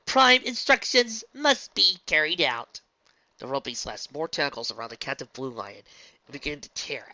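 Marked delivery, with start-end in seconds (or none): 0.0-2.8 slow mechanical voice; flat machine logic; calm robot voice. Acted. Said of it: "calm reply".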